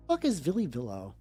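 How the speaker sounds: usual speaking voice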